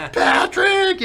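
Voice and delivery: shouted name at the top of the voice; calling for a lost friend. Retold or imitated. Friend searching city calling out.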